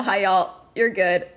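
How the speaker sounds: usual speaking voice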